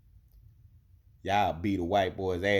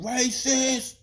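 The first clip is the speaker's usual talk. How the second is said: mock scream; 7 dB louder than usual. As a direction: yell it out the truck window.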